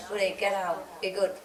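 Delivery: gentle low coaxing of the dog; soft request. Retold or imitated. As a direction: ask the dog nicely, no force. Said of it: "If I say".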